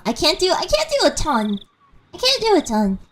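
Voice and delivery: ordinary talk, nothing marked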